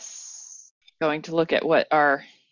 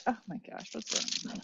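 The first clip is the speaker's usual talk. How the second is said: under breath; 4 semitones higher, 15 dB quieter, faster than usual. Real, not acted. Self-scolding under breath.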